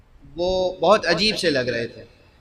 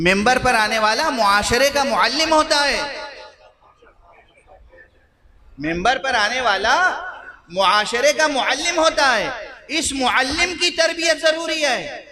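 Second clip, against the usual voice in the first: preaching; 5 semitones higher than usual. Acted. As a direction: lament to the majlis with rising fervour. Pulpit exhortation on the teacher. (پرجوش خطابت، دھیمی اور بلند)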